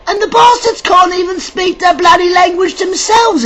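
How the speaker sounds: high, indignant near-shout; indignant outburst. Real, not acted. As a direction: let the outrage burst out, pitched high. Ranting about the English invaders.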